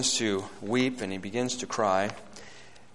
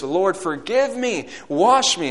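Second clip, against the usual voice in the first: slow, raised prayer of repentance; earnest, louder pleading; repentant plea; 7 semitones higher, 7 dB louder, slower than usual. Retold or imitated. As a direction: cry out to God for forgiveness, preacher-style. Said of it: "he gave to God himself by repentance".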